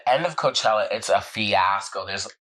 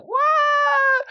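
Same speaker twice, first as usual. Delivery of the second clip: very high, drawn-out shriek of disbelief; astonished squeal; 4 dB louder than usual. Retold or imitated. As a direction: squeal it out in mock amazement. Reacts to surprise guest news.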